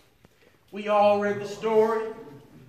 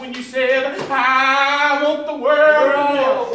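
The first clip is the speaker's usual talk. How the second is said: impassioned sermon building louder, with long cried-out openings; fervent preaching; 6 dB louder than usual. Real, not acted. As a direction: preach it out to the congregation, stretching key words. Preaches with rising fervour.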